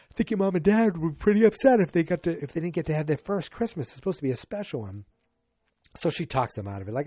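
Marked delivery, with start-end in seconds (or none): 0.1-5.0 higher, quick motherly maid voice; Alice. Retold or imitated. Alice the maid coaxing the kids, higher and quick. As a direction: talk them round, briskly. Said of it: "She goes".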